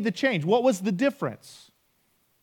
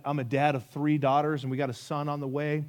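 usual speaking voice

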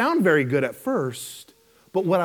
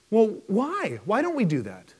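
usual speaking voice

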